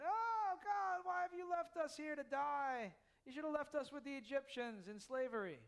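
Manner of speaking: a mock-desperate wail to God; whining Israelites. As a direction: wail it up high like grumbling Israelites. Voicing Israelites crying out.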